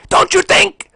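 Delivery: very high, very loud yell; explosive shout. Acted. Streamer snapping at Xbox boss, explosive shout. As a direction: erupt suddenly from a whisper. Explodes after whispering same words.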